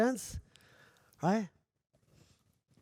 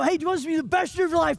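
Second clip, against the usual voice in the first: high, loud, fast pumped question; rally the room like a hype man; hyped host; 9 semitones higher, 7 dB louder, faster than usual. Retold or imitated. Speaker rallies a seminar crowd, loud fast hype.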